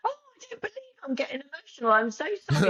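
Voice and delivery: mock-flustered emotional apology; imitated emotional outburst. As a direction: re-enact being suddenly overcome, voice leaping up high. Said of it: "I got emotional, and I went".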